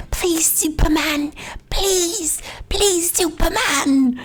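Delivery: put-on high, drawn-out child's plea; pleading little boy. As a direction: imitate a small boy begging to be saved. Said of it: "little boy holding up the flag was like".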